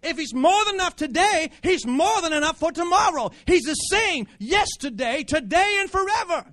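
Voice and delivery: high, loud, ringing sermon climax; full-force preaching. Acted. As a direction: preach it out to the whole church.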